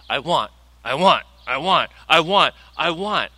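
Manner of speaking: low mock whiny chant of a greedy child; a lightly voiced greedy child; whiny I want. Mocking a greedy prayer.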